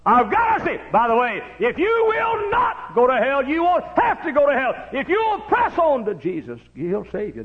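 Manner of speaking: high, loud hollered sermon lines; preaching at full force. Acted. Preacher hollering to the church about Matthew. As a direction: preach it out to the congregation.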